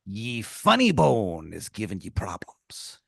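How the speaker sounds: slow, hushed pirate rasp; pirate tomato. Acted. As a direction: whisper it like a conspiring pirate. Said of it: "he goes".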